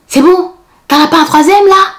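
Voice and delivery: loud, high, exasperated jab; sharp retort. Retold or imitated. Mother snapping at the receptionist over the phones, loud and high. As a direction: snap at him like an irritated mother.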